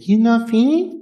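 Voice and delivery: put-on subtle Japanese accent. Waitress asks politely, subtle Japanese accent. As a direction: lightly lift it like the polite waitress. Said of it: "it was subtle".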